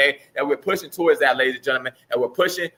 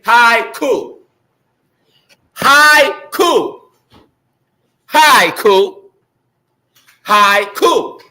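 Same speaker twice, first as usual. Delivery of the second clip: big, slow, split syllables; loud call-and-response; 5 semitones higher, 6 dB louder, much slower than usual. Shown as a demonstration. School announcer getting everybody to say haiku, loudly calling it out. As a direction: call it out loud and wait for the echo. Calls for the audience to repeat.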